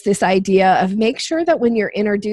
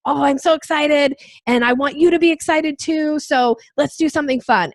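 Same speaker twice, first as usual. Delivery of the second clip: a touch of higher, eager excitement; mock excitement; 6 semitones higher than usual. Voicing the dog-caller's energy, mildly excited. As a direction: sell the fun like an eager puppy-caller. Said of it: "It's this, like".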